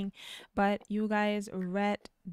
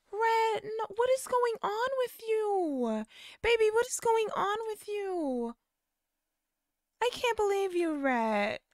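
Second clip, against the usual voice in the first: high, hurt mother's voice; hurt mom; 13 semitones higher than usual. Retold or imitated. Mom pleading with her son, high and hurt.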